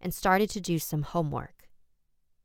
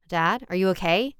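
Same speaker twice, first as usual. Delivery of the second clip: rising fear, louder and higher; scared, building; 4 semitones higher, 4 dB louder than usual. Acted. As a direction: plead through the door, growing panic. Frightened girl at the door.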